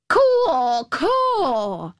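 high croaking caw call; cawing like a crow. A narrator's reading. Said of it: "he said".

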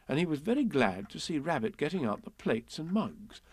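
usual speaking voice

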